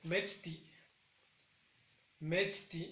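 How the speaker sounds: "metzti" slow with MET stressed; stress on first syllable. Shown as a demonstration. Teacher to students, carefully modelling "metzti" with MET stressed. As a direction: draw out "metzti", weight on MET. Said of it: "igual recae el sonido más grave en la primera sílaba".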